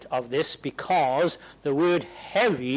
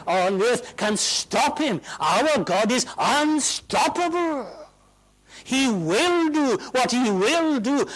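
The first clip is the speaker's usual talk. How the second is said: raised, loud, rising sermon voice; full-force preaching; 9 semitones higher, 5 dB louder than usual. Acted. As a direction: preach it out with mounting power.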